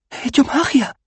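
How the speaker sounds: high, thrilled amazement; drunk with power. A narrator's reading. Rincewind boasts of his magic, giddy and high-pitched. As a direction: exclaim it giddily, way up high. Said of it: "murmuró emocionado, ebrio de poder".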